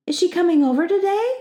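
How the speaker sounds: high-pitched, hopeful question; excited girl hoping for a visit; eager. A narrator's reading. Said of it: "she asked eagerly".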